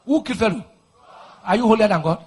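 ordinary delivery

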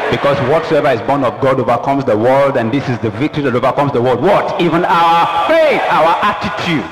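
loud, rising sermon climax; full-force preaching. Real, not acted.

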